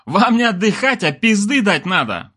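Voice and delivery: high, indignant retort; offended grumble. A narrator's reading. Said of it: "буркнул ... обидевшись на новую обзывалку".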